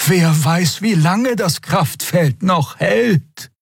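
high, drawn-out worried question; urging warning. A narrator's reading. Xea fearing the force field will fail, urging. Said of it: "mahnte Xea".

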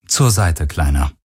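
low, falling, almost bored; fast gelangweilt. A narrator's reading. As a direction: order him aside as if mildly bored. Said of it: "Heska klang fast gelangweilt". (fast gelangweilt, tief abfallend)

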